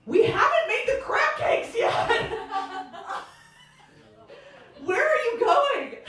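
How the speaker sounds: high, pleading protest; high-pitched call to stop him leaving; plaintive call. Retold or imitated. Pleading with departing date, high.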